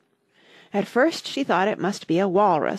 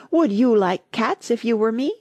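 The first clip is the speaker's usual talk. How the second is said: indignant mouse, pitched higher; shrill, passionate; 4 semitones higher, 4 dB louder than usual. A narrator's reading. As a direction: throw the question back with passion. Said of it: "in a shrill, passionate voice".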